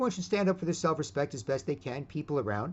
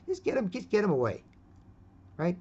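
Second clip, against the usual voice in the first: usual speaking voice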